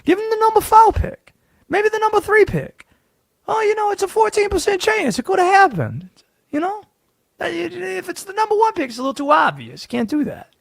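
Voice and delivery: a conspiracy sports host drawling his rigging theory; Southern Conspiracy Josh voice. Acted. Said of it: "Where did this accent come from?".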